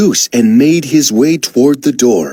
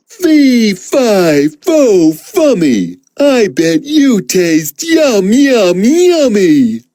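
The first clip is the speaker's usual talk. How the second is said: giant voice; 6 semitones higher, slower than usual. Acted. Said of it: "said the".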